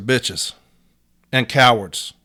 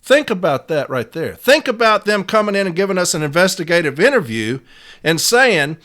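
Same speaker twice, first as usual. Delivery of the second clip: ordinary talk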